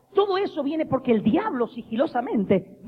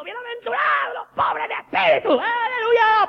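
much higher, shouted, crying-out sermon; full-force preaching shout; 14 semitones higher, 4 dB louder than usual. Retold or imitated. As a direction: preach it to the whole hillside. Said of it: "El Señor está diciendo". (Jesús predicando a gritos en el Sermón del Monte, agudo y fuerte)

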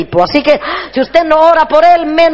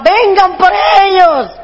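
pleading call; 6 semitones higher, slower than usual. Retold or imitated. Calling the parents, exhausted.

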